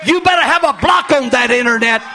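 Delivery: loud, high pulpit shouting; preaching at full force. Acted. Pastor shouting warnings at his flock about the internet. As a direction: preach it hard to the congregation.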